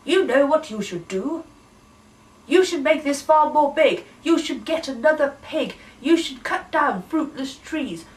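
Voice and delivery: bossy lecturing. Acted. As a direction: lay down the law like a know-it-all. Bossy Mistress Should lectures farmer.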